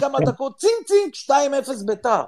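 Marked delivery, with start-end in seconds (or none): 0.6-1.1 high-pitched mimicked "tsing, tsing" alert; imitated phone pings. Father retells goal alerts arriving, high imitated pings. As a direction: voice two sudden push-notification pings.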